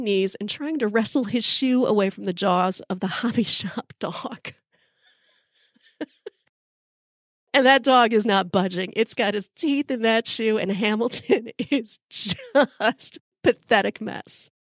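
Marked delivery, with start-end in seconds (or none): 2.9-6.1 real laugh breaking into the words; amused, softer, giggling mid-phrase. Real, not acted. Cracking up at own story. 11.5-14.5 slow, halting words through real laughter; struggling to get the words out, amused; laughing. Real, not acted. Cracking up at own story.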